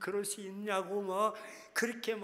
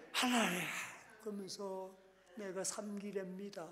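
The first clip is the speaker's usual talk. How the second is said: tearful prayer; 7 dB quieter than usual. Shown as a demonstration. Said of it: "그리고 우는 거야".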